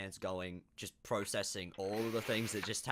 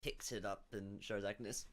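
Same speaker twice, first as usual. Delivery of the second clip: ordinary delivery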